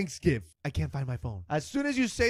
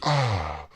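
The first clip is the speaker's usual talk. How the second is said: low contented ahh of relief; relieved sigh; 11 semitones lower than usual. Shown as a demonstration. Showing the crowd the sound you make peeing, relieved ahh. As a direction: let out a long relieved ahh. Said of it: "that's the sound you make when you pee".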